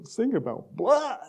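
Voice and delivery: a touch of squeamish churchgoer; mock disgusted people. Pastor mimicking people who dislike the hymn, mock disgust. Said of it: "people are going".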